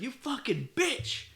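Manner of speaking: high, drawn-out curse; mock furious insult. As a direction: re-enact cursing out a sister, high and slow. Retold insult to sister in fight.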